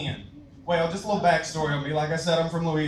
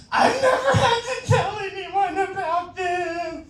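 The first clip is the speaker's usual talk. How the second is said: sing-song confession; 13 semitones higher, slower than usual. Retold or imitated. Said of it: "the only thing he can say".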